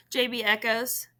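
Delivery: ordinary delivery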